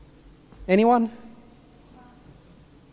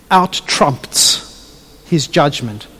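slowed emphasis; 6 semitones lower, much slower than usual. Real, not acted.

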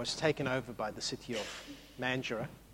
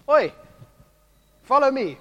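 a sharp, higher call of Oi then follow me; a bold summons, louder and higher; 9 semitones higher, 10 dB louder than usual. Retold or imitated. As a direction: call him over with a firm shout. Jesus calls the disciples.

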